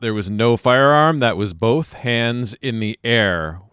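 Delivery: slow, emphatic scolding; indignant, drawn-out rebuke; yelling at officers. Acted. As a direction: dress them down word by word. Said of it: "I'm yelling at the officers".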